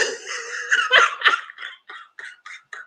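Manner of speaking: high, breathless laughing fit; real laughter. Real, not acted. Recapper done in by the stripper bit, real laughing fit. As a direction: collapse into helpless high laughter.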